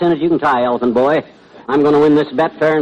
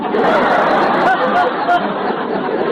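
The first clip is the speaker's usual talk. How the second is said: laughter. Acted. Audience laughter.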